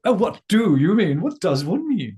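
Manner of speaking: high, loud, overly polite voice; customer service voice. Pretend. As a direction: put on a fancy service voice.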